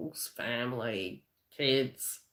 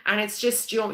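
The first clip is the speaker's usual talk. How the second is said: ordinary talk, nothing marked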